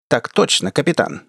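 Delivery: usual speaking voice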